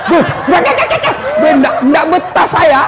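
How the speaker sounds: mock terrified jinn yelling no; frightened jinn. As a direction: squeal it out like a fleeing genie. Jinn fleeing nagging wife.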